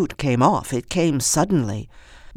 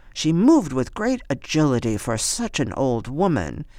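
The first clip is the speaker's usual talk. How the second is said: usual speaking voice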